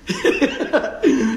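loud, high cackle; real delighted laughter; hearty laugh. Real, not acted. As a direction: burst out laughing at your own tease. Laughing at his teasing.

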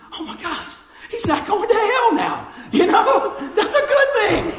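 high-pitched, loud mock elation with laughter; excited mother-in-law. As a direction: imitate an overjoyed relative bursting with relief. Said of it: "She was all excited".

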